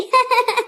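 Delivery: mocking laugh. Women joking about the lisping bride, amused laugh.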